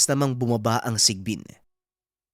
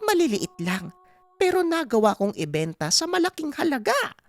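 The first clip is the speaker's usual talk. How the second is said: put-on delighted old mother, pitched high; mother gushing to her son, climbing higher as she goes; Aling Pasing; 12 semitones higher than usual. (tuwang-tuwang matandang ina, mataas ang boses)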